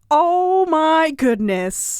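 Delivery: drawn-out, high, loud awe; mock amazement. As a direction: gush slowly in amazement. Excited reaction to pen holder news.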